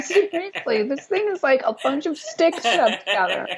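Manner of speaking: high, worked-up disbelief; incredulous. Real, not acted. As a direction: protest that it's only sticks. Incredulous argument about the cabin.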